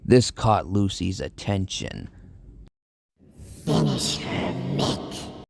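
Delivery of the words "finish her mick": commanding. Acted. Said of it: "Lucy commanded".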